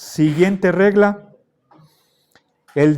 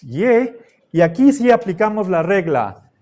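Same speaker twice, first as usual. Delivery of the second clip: normal talking voice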